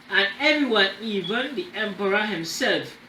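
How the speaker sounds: ordinary delivery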